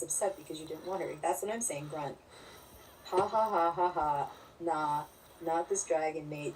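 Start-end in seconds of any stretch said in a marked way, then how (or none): 3.1-4.3 loud burst of real laughter. Real, not acted. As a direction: belly laugh at the joke. Big laugh at joke.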